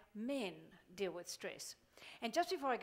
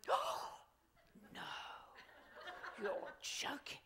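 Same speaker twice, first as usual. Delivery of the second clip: hammed-up shocked sympathy, swinging from whisper to high cries; mock gasping friend; 11 semitones higher, 8 dB louder, much slower than usual. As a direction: play the horrified friend hearing gossip. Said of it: "the woman who's listening is going".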